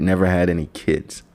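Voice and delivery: ordinary talk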